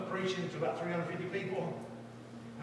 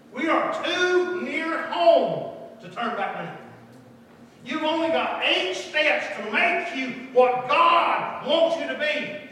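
slow, emphatic preacher's delivery, swelling louder; fervent preaching; 7 semitones higher, 10 dB louder, slower than usual. Acted. Preacher urging the congregation not to turn back, fervent preaching. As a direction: stretch the key words with full-force conviction.

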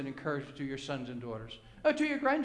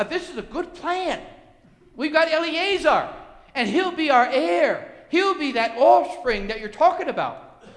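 higher, louder pitch to God; pleading Abraham; 7 semitones higher, 6 dB louder than usual. Acted. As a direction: sell the plan eagerly to the Lord. Voices Abraham pitching his plan.

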